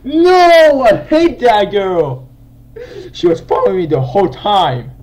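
high, loud frustrated outcry; angry wailing. Real, not acted. Gamer followed the whole time by that girl, furious wailing. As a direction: wail and yell over the lost round. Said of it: "back from all this angerness".

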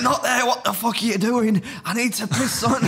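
dad shouting at his son from the passenger seat, high and panicked; desperate dad yelling. Retold or imitated. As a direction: play the frantic father who needs to pee. Dad yelling in drive-thru story.

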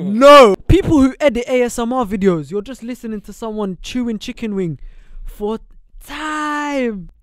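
high, loud outburst of disgust; loud indignant rant. Real, not acted. Podcaster asked to do ASMR videos refuses, ranting loudly. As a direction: raise voice and pitch, stretching out the last word.

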